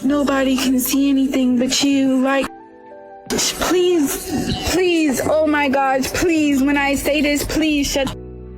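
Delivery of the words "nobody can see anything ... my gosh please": ordinary delivery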